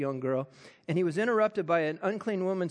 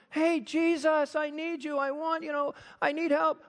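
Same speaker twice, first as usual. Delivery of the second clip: mock yelling; 8 semitones higher than usual. Crowd clamors for Jesus' attention, mock yelling. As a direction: plead over the others for attention. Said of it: "they're all yelling".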